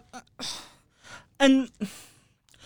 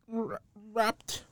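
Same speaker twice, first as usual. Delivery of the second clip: ordinary talk, nothing marked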